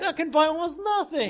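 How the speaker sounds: loud, high, over-the-top cheer; hyped-up mocking outburst; sarcastic mock excitement. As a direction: exclaim it high and loud with fake glee. Sarcastic outburst at tiny store credit.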